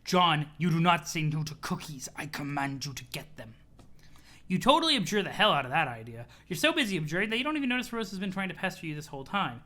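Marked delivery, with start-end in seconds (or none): 0.0-3.5 mock command. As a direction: order him low and firm. Mock order to John.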